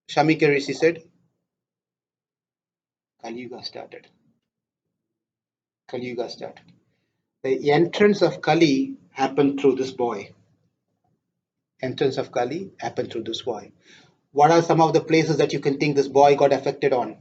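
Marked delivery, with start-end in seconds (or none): 3.2-4.1 hushed lament. Acted. Sage's grave lament. 5.9-6.6 soft echo. Shown as a demonstration. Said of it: "repeated softly". 11.8-13.7 quiet repeat. Shown as a demonstration. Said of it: "repeated softly".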